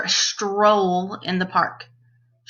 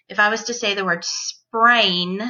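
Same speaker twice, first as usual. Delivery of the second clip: normal talking voice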